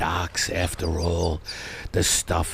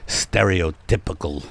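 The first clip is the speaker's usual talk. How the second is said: usual speaking voice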